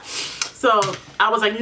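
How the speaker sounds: normal talking voice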